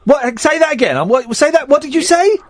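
high-pitched, incredulous questioning; taken aback. Real, not acted. Host challenging caller's jibe, high and incredulous.

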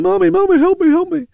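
put-on high, pleading little girl voice; child crying for help. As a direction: call out like a stuck child.

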